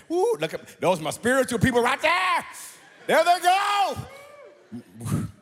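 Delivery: high, loud whoop at the crowd; excited cheer. Real, not acted. Preacher cheering congregation waving paper Bibles, loud excited whoop. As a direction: cheer them on with a big woo.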